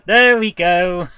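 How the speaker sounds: loud, high, drawn-out cheer; real delighted outburst; exultant. Real, not acted. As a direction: crow it slowly and loudly in triumph. Delighted at finding the accented word.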